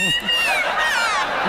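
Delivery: shrieking laugh. Real, not acted. Crowd shrieks at the pun.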